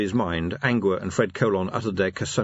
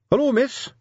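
higher, louder quick greeting; 4 dB louder than usual. Acted. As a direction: get a hasty hello out. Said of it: "said Carrot quickly".

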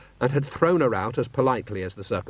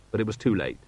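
ordinary delivery, nothing marked

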